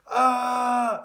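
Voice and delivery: high, loud scream of pain. A narrator's reading. Tom screaming as his pyjamas start smoking. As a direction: yelp in alarm. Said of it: "screamed Tom".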